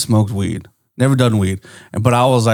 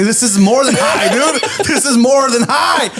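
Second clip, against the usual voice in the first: high insistent protest, rising and stretching out; hammed-up frantic insistence; mock panic; 9 semitones higher than usual. Insisting to his friend it's serious, acted panic.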